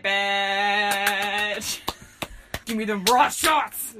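slow, loud, drawn-out holler; rowdy mock shout. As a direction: holler it like a drinker demanding shots. Playing a rowdy drinker.

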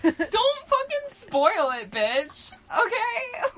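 loud, slow playful scold; mock angry yell. Real, not acted. Reader yelling at friend not to spoil the story. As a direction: snap at the spoiler, half laughing.

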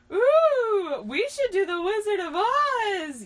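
high, loud, drawn-out eager cry; hammed-up gleeful shout; excited yell. A narrator's reading. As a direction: burst in with an over-keen suggestion. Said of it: "yelled Sora".

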